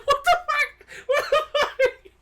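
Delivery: very high retold squeal, breaking into laughs; helpless laughter. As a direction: imitate the stunned buddy while cracking up. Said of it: "I'm laughing like I am now".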